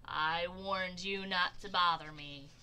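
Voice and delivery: slow, loud bear warning; cross Bear. A narrator's reading. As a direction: warn slowly and loudly like a grumpy bear. Bear scolds Groundhog.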